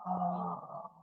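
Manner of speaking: soft, long breathy sigh out; exhaling sigh. Shown as a demonstration. Teacher letting the held breath go, soft exhaling sigh. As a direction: let the whole breath fall out softly.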